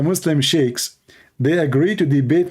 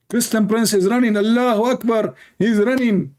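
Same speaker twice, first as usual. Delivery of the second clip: mocking gloat; 10 semitones higher than usual. Mocking opponents' gloating cries.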